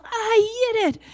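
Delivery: put-on a toddler crowing her win; proud toddler. Toddler calling out after setting down her cup, proud little voice. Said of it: "she cries".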